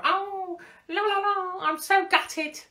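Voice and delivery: high, sing-song mock of a gutted influencer; mocking Molly voice. Mocking the ex-partner's public statement in a high, airy put-on voice. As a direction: put on a breezy high celebrity whine. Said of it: "Does she talk like that?".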